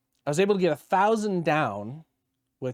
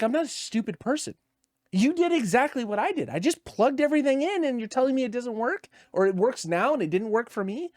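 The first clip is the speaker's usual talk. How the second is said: higher, heated disbelief at the tech; exasperated, pitched-up rant; retold fury; 8 semitones higher than usual. Ranting at the tech who just plugged it in, heated and high. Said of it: "I was so furious".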